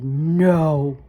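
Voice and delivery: low, loud flat refusal; firm no. Acted. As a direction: shut the question down. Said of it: "Her mother said".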